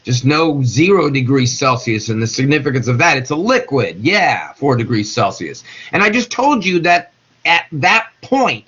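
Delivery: ordinary delivery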